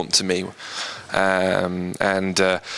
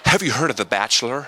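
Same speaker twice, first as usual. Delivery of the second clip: higher, imitated American accent; lame American accent; 4 semitones higher than usual. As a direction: put on a mock American voice. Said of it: "that's my lame ass American accent".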